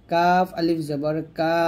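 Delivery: slow spelling, light front কা held long; thin, light letter. Shown as a demonstration. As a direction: keep কা thin and stretch it. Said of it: "পাতলা".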